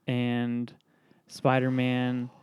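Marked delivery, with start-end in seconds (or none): none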